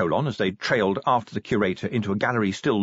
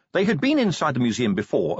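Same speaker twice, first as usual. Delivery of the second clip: ordinary delivery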